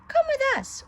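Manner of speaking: put-on very high, loud child's call; eager urging. A narrator's reading. Boys luring Pinocchio away, eager high call. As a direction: beckon him like excited kids. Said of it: "urged the boys".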